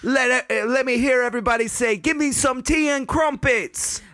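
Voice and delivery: high hyped-up British host; mock British MC. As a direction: ham up a British party call.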